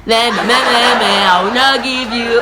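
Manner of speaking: put-on childish taunting bleat with a laugh; cheeky kid mocking big brother; mocking meh meh. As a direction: stick your tongue out at him with your voice. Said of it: "I was still doing".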